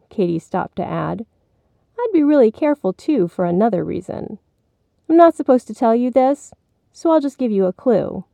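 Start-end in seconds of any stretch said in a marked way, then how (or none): 2.0-8.2 lightly voiced sister hinting at a secret; teasing big sister. A narrator's reading. Katie warning her little brother, teasing.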